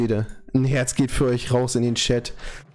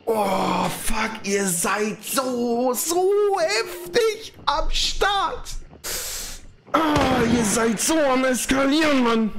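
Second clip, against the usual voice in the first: near tears; 8 semitones higher, slower than usual. Real, not acted. Overwhelmed by donations, voice trembling.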